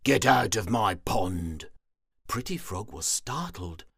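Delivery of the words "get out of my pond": loud, grumpy order; grumpy shout. Acted. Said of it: "he grumped".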